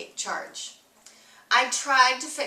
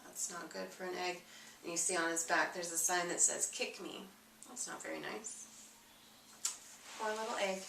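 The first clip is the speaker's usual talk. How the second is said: soft aside; 4 semitones lower, 11 dB quieter, much faster than usual. Real, not acted. Reader commenting on the picture to a child, quiet. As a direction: lean in and murmur about the picture.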